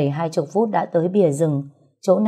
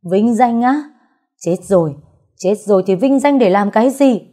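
louder and higher, bitter disbelief rising to a cry; sister's bitter anguished outcry, voice climbing loud and high; bitter outburst; 4 semitones higher, 6 dB louder than usual. Acted. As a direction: hurl the words back at him in bitter grief. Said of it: "Cô bật cười chua chát".

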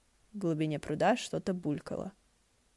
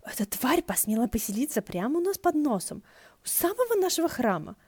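indignant cursing; 6 semitones higher than usual. Acted. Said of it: "ругался хуже, чем утонувший боцман".

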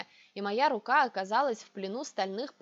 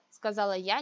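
plain reading voice

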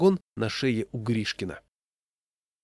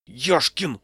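higher, louder rebuke; hissed rebuke; 4 semitones higher, 5 dB louder than usual. A narrator's reading. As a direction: call him sharply to order. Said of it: "зашипел я". (возмущённый окрик)

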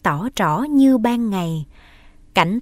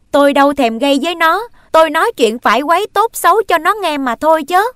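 loud, high and fast; defiant reply; 7 semitones higher, 6 dB louder, faster than usual. Acted. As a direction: talk back hotly. Boy defends himself in quarrel.

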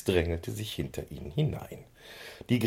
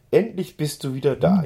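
higher, louder cry of delight; joyful cry; 9 semitones higher, 5 dB louder than usual. A narrator's reading. Lotte hugs her pony again, joyful higher cry. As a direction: squeal with joy at the reunion. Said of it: "jauchzte sie".

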